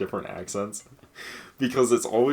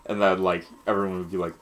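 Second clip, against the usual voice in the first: usual speaking voice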